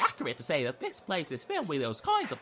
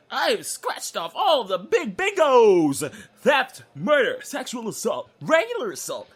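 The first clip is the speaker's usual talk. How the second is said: gleeful boast; 6 semitones higher, 6 dB louder than usual. Acted. Boasting of his crimes.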